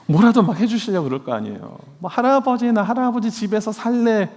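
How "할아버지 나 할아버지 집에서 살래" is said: higher, pleading grandchild; little grandkid asking to move in; child's voice. Pretend. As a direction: play the little grandchild begging.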